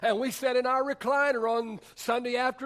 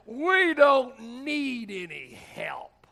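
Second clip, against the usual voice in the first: loud, slow, high mock rebuff; indignant refusal; 4 semitones higher, 6 dB louder, much slower than usual. Alcoholic brother turning down the jail minister, indignantly. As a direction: draw it out, pitched up, waving help away.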